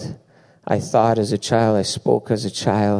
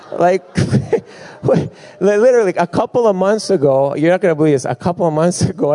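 higher, louder and fast with laughter; excited and laughing; 7 semitones higher, 4 dB louder, faster than usual. Real, not acted. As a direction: rush out the story, barely holding in laughs. Laughing, excited lead into story.

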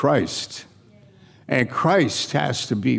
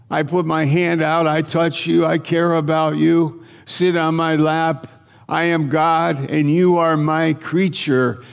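higher, sustained declaration on a level pitch; voice of God; 4 semitones higher than usual. Retold or imitated. God calling his creation to come close, earnest proclamation. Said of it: "God talks the same way to us".

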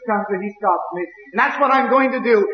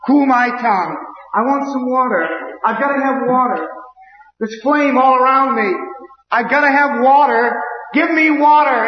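crying out for water; 4 semitones higher than usual. Retold or imitated. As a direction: cry out from the flames. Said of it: "And he cries and he cries".

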